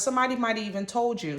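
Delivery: normal talking voice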